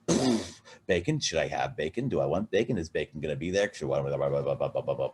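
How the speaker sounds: low, muttered inner monologue; mumbling inner voice. Retold or imitated. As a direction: grumble the worries to yourself. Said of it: "the other gathering sense goes".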